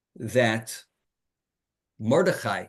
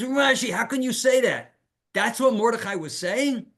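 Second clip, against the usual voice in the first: high, louder, incredulous challenge; exasperated disbelief; 8 semitones higher, 3 dB louder than usual. Acted. Maharsha challenging Rashi's reading, exasperated. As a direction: protest as if tearing your hair out. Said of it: "he literally tears his hair out".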